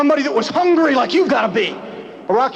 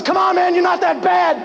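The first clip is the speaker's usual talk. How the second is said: mock your opponent with a grin; Rocky taunting Clubber Lang; 10 semitones higher than usual. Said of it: "looks at him and says".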